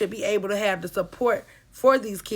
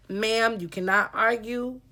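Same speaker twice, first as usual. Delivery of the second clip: ordinary talk, nothing marked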